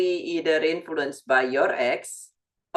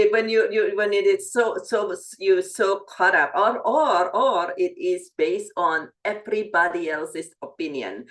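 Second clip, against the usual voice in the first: usual speaking voice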